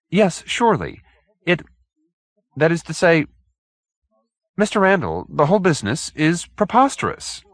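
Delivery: high, halting and fussy; nervous stammering gentleman. Acted. Hoag stammering his odd case to the detective, high and halting. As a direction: stumble over the words, embarrassed.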